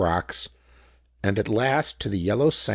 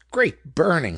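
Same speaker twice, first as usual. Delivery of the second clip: higher, louder astonished outburst; exclaiming; 7 semitones higher, 4 dB louder than usual. A narrator's reading. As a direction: blurt it out in amazement. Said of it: "he exclaimed".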